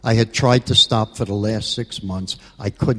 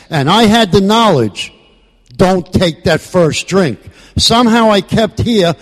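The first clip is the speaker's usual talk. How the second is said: impassioned shout; 8 semitones higher, 7 dB louder than usual. Real, not acted. Peak of impassioned AA testimony.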